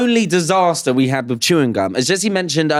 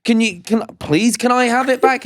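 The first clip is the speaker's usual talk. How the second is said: pleading guard; 8 semitones higher than usual. Retold or imitated. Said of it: "and I'm like".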